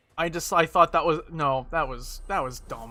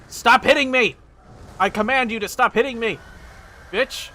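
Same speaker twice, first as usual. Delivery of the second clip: angry yelling; 7 semitones higher, 5 dB louder than usual. Real, not acted. Yelling at game enemy.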